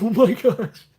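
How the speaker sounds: high, loud fangirl gasp of words; high-pitched mock fan excitement; starstruck freak-out. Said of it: "I'll freak out".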